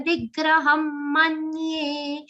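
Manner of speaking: Nigraham with ni pressed into the ga; stress on ni. Shown as a demonstration. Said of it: "Ni has a stress of ga".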